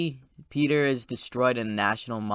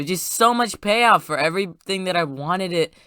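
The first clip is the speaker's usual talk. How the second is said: ordinary talk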